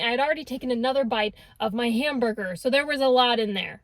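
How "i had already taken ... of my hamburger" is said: ordinary talk, nothing marked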